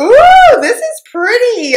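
high-pitched delighted "ooh" that swoops up; delighted gushing. Real, not acted. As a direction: gush over something pretty. Delighted reaction to a gift.

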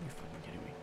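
breathy whisper of disbelief. Real, not acted. Gamer locked in the stall, whispering in disbelief. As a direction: hush it, stunned at being locked in.

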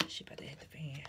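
breathy warning whisper, barely voiced. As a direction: lean in and whisper the warning like a secret. Dramatic warning repeated as aside.

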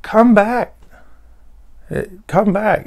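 high mock scream, not full volume; imitated screaming. Someone screaming down to the dying patient, restrained mock scream. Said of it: "And someone's screaming".

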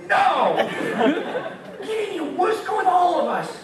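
high, loud panicked mock protest; hammed-up protest. Servant Purah protesting to Gideon, hammed-up panic. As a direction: ham up a servant begging. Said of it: "can you imagine Pierre going".

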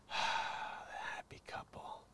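slow unvoiced whisper; sarcastic whisper. Real, not acted. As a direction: breathe it out sarcastically, slow and hushed.

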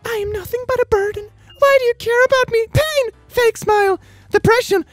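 hammed-up tragic squeal; mock despair. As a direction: wail it like an overdramatic angsty teen. Acts out angsty post.

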